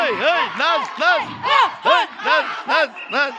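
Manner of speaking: high, excited calls to dance; excited cheering. Real, not acted. Fan urging players to dance.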